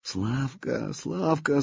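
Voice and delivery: a mild, aching plea, rising on the repeat; with longing. A narrator's reading. Princess pleading with Slavka in despair, mild longing. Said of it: "с тоской проговорила Рогнеда".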